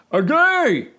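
high, loud yelp as he's bitten; shout of pain. Acted. Father bitten on the leg by Turtle, yelling. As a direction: yell out from the sudden bite. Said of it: "shouted the father".

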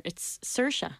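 "Saoirse" as SUR-sha; correct Saoirse. Shown as a demonstration. Correcting her co-host's pronunciation of her name, careful pronunciation. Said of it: "how does it".

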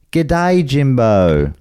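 high, falling Australian "g'day"; hammed-up Australian greeting. Host greeting an Australian reviewer, playfully broad Australian accent.

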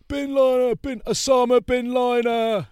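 high, loud, slow drawn-out taunt; jeering fans. Retold or imitated. Fans heckling during filming, high slow loud jeer. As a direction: chant it out like a crowd of lads. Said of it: "they're like".

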